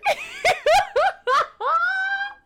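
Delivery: high, loud burst of laughter; real, high-pitched laughing fit. Real, not acted. Laughing at own story.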